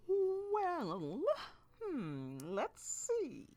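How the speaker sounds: playful pretend musing; mock pondering. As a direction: drag it out like a fake deliberation. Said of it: "pretending to ponder the question".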